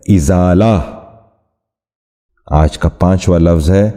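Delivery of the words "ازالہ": ازالہ with "i" first, izaala; correct pronunciation. Shown as a demonstration. Teacher repeating for viewers the correct ازالہ, izaala. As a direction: say ازالہ as izaala, not azaala. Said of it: "درست تلفظ ہے".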